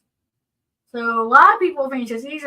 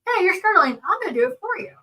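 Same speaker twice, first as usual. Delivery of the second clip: higher-pitched imitation of a presumptuous helper; overeager helper; 7 semitones higher, faster than usual. Stranger assuming the diner needs help, high-pitched mock helpfulness. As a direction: mimic someone jumping in to help, pitched up. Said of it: "they might just assume that".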